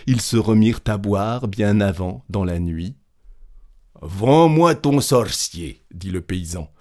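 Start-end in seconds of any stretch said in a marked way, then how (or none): 4.0-5.8 slower, louder and higher plea; eager peasant. A narrator's reading. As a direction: press hard for the purchase. Peasant begs to buy the sorcerer. (paysan avide et pressant)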